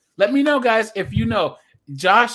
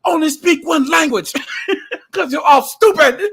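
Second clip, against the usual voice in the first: loud, higher mock German heel taunting the crowd; 9 semitones higher, 6 dB louder than usual. Streamer voicing a German wrestler taunting American fans, shouted mock German accent. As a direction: sneer at the Americans in a hammed-up German accent, laughing through it. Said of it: "He's like".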